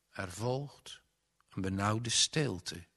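usual speaking voice

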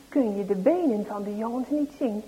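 ordinary delivery

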